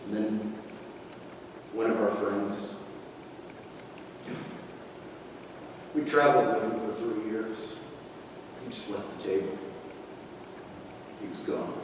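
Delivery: low, very soft, fading almost to nothing; subdued and grieving, trailing into near silence; hushed sorrow. Acted. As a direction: recall a friend's betrayal, voice sinking away. Acted grief at friend's departure.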